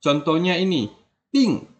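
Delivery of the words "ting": "ting" falling sharply from very high; fourth tone. Shown as a demonstration. Teacher introducing the example, "ting" in a sharp fourth-tone fall. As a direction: drop "ting" hard from a high start. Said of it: "ting ini nada keempat".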